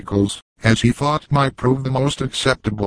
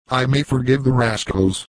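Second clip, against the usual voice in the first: usual speaking voice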